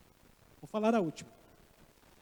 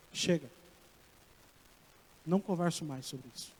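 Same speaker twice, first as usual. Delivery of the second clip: a low, quieter hint of a stern God closing the matter; God's final word; 9 semitones lower, 7 dB quieter than usual. Preacher voicing God ending Abraham's bargaining, low and final. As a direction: let God shut the talk down, low and quiet. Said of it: "Deus falou".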